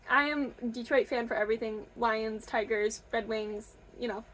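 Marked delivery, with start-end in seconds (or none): none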